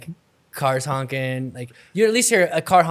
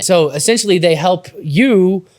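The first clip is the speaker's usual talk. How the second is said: upbeat, louder sponsor read; 5 semitones higher, 7 dB louder than usual. Acted. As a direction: sell it like a radio ad. Reads sponsor ad.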